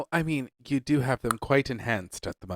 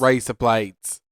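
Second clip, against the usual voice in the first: Australian accent; 4 dB louder, much slower than usual. Shown as a demonstration. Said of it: "sounds like Australian".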